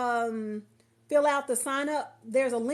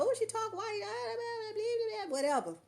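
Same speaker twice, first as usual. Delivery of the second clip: high mocking whine of gossipers; 10 semitones higher, 4 dB quieter than usual. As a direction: mimic petty gossip, high and whiny.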